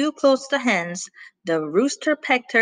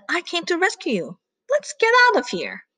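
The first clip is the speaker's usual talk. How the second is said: urgent dog voice; 9 semitones higher than usual. Acted. Plato urges Bella.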